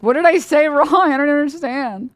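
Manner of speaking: high, loud, bewildered whine; high and loud with a laugh in it; mock confused. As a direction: play baffled innocence. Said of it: "I was like".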